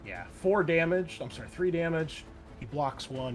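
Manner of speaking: normal talking voice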